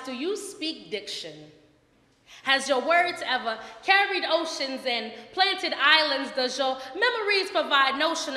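fierce slam delivery building to loud high climaxes; defiant spoken word anthem; spoken word poem. Acted. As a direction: proclaim it proudly like a slam poet. Performs poem I Am Woman.

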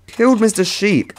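high-pitched lament; dismayed wail. Real, not acted. Player realises they killed their sheep, high dismayed wail. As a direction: wail it like a mishap you caused. Upset at killing the pet sheep.

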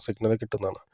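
ordinary talk

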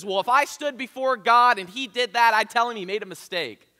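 loud, high defiant boast; defiant man. Retold or imitated. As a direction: hurl it out indignant. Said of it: "And he says".

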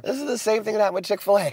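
ordinary delivery

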